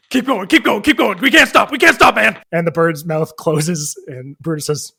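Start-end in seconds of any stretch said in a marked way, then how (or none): 0.1-2.4 higher, loud panicked yell to the driver; panicked shout. Acted. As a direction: urge the driver on in a frantic shout. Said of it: "another voice says".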